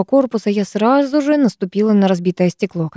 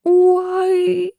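long, high sustained howl; sudden high "ooh-ai" wail; acted howl of fright; frightened wail; 11 semitones higher than usual. A narrator's reading. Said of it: "внезапно взвыла под моим ухом Руднева".

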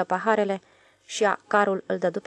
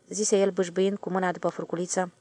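ordinary delivery

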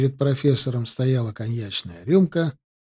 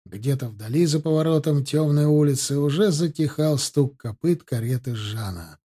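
plain reading voice

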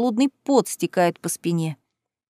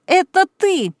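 high, loud accusing cry; angry shriek; 9 semitones higher, 4 dB louder, slower than usual. Acted. Cousin recognising Kaitlyn, enraged accusing shriek. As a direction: hurl it at her, enraged. Cousin unmasks her in public.

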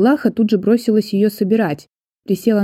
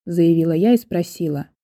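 ordinary delivery

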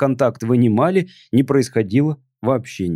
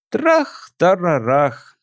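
tipsy alien voice; 4 semitones higher, much slower than usual. Acted. Alien mimics explosion.

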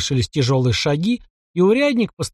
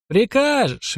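ленивое передразнивание прикажешь, с падением; вяло, насмешливо повторить прикажешь; вяло передразнил; 8 semitones higher, much slower than usual. A narrator's reading. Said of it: "вяло передразнил его майор". (майор вяло передразнивает купца, насмешливо)

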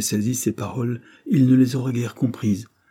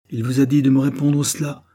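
raised, louder accusation; menacing exclamation; 5 semitones higher, 6 dB louder than usual. A narrator's reading. Angry governess accusing Cyril, menacing and loud. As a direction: cry out with a threat behind it. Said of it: "s'écria-t-elle, la menace dans la bouche".

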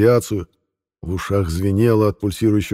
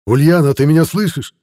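calling out loudly, higher; shouting; 7 semitones higher, 6 dB louder than usual. Acted. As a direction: shout her name from far off. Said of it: "Едва различимый крик".

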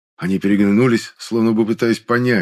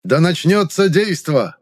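fervent announcement; 8 semitones higher, slower than usual. A narrator's reading. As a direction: launch the tournament with gusto. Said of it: "задорно объявил оратор, легко докрикивая своим мощным голосом".

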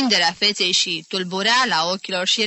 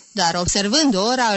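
plain reading voice

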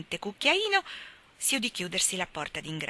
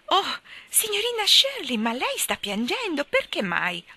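much higher and louder, startled; surprised; 9 semitones higher, 4 dB louder than usual. Acted. Carlotta notices Miss Shirley crying, startled. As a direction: cry out in sudden concern.

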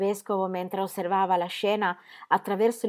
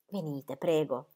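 softer, haughty near-hiss; arrogant, almost hissing; 3 semitones lower, 5 dB quieter, slower than usual. A narrator's reading. The bishop hissing arrogantly at Herr Dremmel. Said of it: "con protervia, quasi sibilando".

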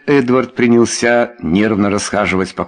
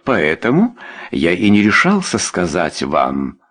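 plain reading voice

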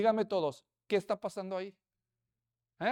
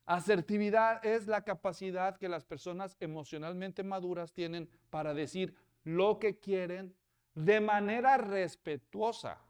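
ordinary talk

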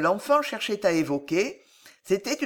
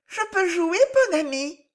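put-on very high-pitched child's question; little boy asking; 11 semitones higher, 5 dB louder than usual. A narrator's reading. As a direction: ask permission like a small boy. Child asks to play. (voix très aiguë de petit garçon)

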